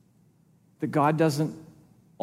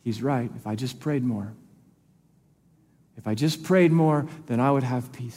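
quiet ashamed thought; 6 semitones lower, 4 dB quieter than usual. Retold or imitated. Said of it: "you're thinking to yourself".

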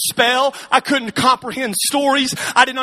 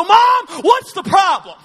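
put-on upset teen asking his mom what's wrong; frustrated kid; 7 semitones higher than usual. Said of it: "and I would go".

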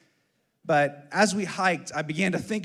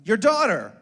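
higher, louder imitated little-girl answer; imitated daughter, pitched up and loud; 5 semitones higher, 9 dB louder than usual. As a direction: blurt it out like a child who knows. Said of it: "She says".

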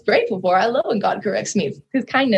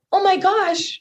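amazed; 9 semitones higher, slower than usual. Real, not acted. Overwhelmed moment with God.

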